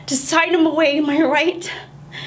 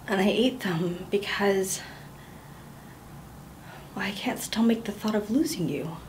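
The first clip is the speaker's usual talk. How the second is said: hushed, unvoiced confession; whispered confession; 8 semitones lower, 14 dB quieter than usual. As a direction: breathe it out as a secret nobody else may hear.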